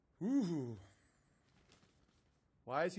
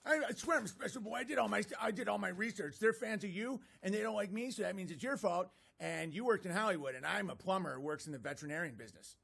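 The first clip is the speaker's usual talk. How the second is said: put-on high whiny eight-year-old parody; childish mock voice; 4 semitones higher than usual. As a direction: play him as a sulky kid. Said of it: "mocking the critic".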